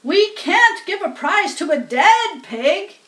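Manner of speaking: loudspeaker asking. A narrator's reading. Loudspeaker asks crowd about fainted pig, alarmed announcer. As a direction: call out a hint of alarm. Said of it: "said the loudspeaker".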